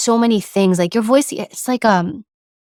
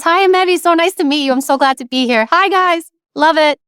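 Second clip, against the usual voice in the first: high bubbly voice; 7 semitones higher than usual. Shown as a demonstration. Guest showing the wrong voice, high and gushing. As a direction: gush like an eager guest trying too hard. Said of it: "Do I talk like this?".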